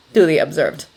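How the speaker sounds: usual speaking voice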